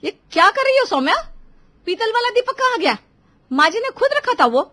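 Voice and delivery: high, loud furious outburst; भड़की हुई. Acted. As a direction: explode at the sister-in-law. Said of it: "तो वो भड़क गई". (ग़ुस्से में चिल्लाती जेठानी)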